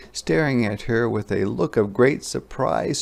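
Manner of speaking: plain reading voice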